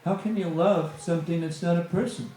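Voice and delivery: ordinary talk, nothing marked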